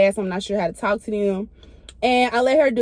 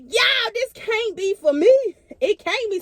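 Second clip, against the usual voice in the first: panicked disbelief; 11 semitones higher than usual. Retold or imitated. Panicked disbelief at euthanasia room.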